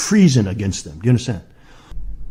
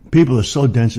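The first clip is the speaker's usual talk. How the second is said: ordinary talk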